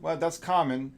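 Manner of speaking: normal talking voice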